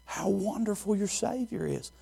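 ordinary talk, nothing marked

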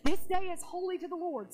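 acting out an emphatic leader; urgent retold command. Acting out Nehemiah to weepers.

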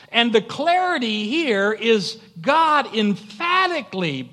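high, loud pulpit proclamation; full-force preaching. Acted. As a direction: drive each point home to the congregation.

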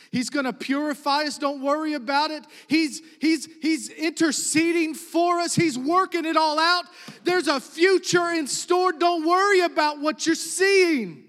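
swelling sermon climax; rising preaching. Acted. Preacher builds to full force.

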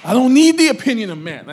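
loud, high preacher's cry; ringing pulpit proclamation; forceful declaration. Acted. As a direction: declare it boldly to the whole church. Preacher declares it for congregation to repeat.

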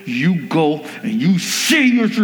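a hint of talking through gritted teeth. Parent telling kid to apologize, through teeth. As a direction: clench and press the words out. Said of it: "speaking through your teeth".

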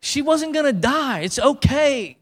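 high-pitched incredulous protest; retold exasperation. Preacher retelling his reply to Momo, high and incredulous. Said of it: "I was like".

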